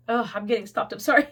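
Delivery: ordinary talk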